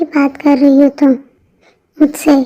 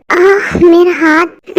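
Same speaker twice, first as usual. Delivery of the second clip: slow pained moan over the hand; pained cry; 3 semitones higher, much slower than usual. Acted. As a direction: drag it out like the wrist really hurts. Hand hurts, cries out.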